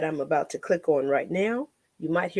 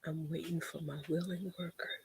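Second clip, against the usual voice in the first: breathy whispered aside; whispering; 14 dB quieter than usual. Real, not acted. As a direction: keep it a soft whisper. Whispered aside while waiting.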